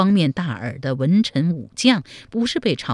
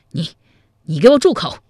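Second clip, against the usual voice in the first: loud, much higher furious cry; exasperated shout; 3 semitones higher, 7 dB louder, faster than usual. Acted. General silencing his adviser, furious shout. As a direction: explode at him to shut up. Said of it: "气急败坏地说".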